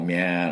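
ミャー with a wide, stretched English "ae"; ミャー held long as in "na-" of natural; meowing "nya" vowel. Shown as a demonstration. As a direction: draw out ミャー like a cat's cry. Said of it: "ミャーの音で".

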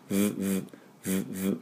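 soft short lip vibrations; buzzing V sound. Shown as a demonstration. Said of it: "唇を振動させるのがVの音です". (唇を振動させる小さなVの音)

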